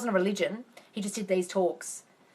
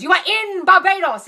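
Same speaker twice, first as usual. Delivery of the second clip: much higher, louder exasperated repeat; exasperated shout; 11 semitones higher, 10 dB louder than usual. Retold or imitated. Abdullah dismissing Neville at the door, exasperated shout.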